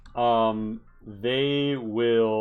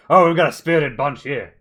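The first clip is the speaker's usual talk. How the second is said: higher and louder, eager to join the brawl; delighted NPC; 5 semitones higher, 7 dB louder than usual. Acted. NPC joining the goblin melee, cheerfully excited. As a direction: cheerfully push in for a drink, quick.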